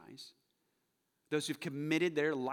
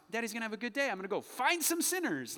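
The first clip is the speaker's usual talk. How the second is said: high, gleeful pretend eagerness; mock excited; 9 semitones higher, 4 dB louder than usual. Dad kissing kids goodbye before work, mock excited. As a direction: sound absurdly thrilled to set off. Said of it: "I don't get excited about that".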